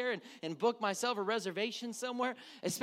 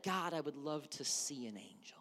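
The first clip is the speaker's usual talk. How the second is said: hushed whispered longing; whispered prayer; 8 semitones lower, 9 dB quieter than usual. Retold or imitated. Congregant's imagined prayer, whispered. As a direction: whisper it like a secret wish. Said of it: "someone in here ... has said".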